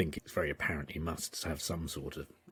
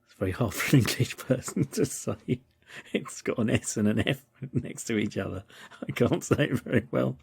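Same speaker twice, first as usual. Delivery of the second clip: amused; 7 semitones higher, 7 dB louder than usual. Real, not acted. Giggly over failed pronunciation.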